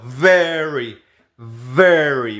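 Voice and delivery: "Very" with buzzing teeth-on-lip v; "Very" with a voiced labiodental v; English v in very. Shown as a demonstration. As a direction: drill "Very" with a real v. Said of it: "Ejercítalo".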